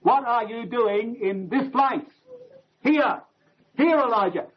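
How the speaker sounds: here hit hard and falling; stress on here. Shown as a demonstration. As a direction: lean on the word here, then repeat it. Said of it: "the emphasis is on that word here".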